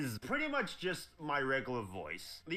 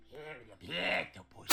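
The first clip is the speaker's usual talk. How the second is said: put-on cartoon character voice; warm-up catchphrase voice. Shown as a demonstration. Voice actor demos his warm-up phrase in character. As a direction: slip into the cartoon villain's voice. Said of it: "And I would just go".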